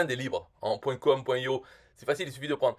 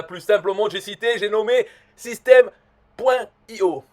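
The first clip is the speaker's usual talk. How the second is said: higher and louder, like a booming ad announcer; hype announcer; 7 semitones higher, 7 dB louder than usual. Acted. The expert hyping his own platform, booming announcer voice. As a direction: sell the brand name like a show host. Grand self-introduction of the brand.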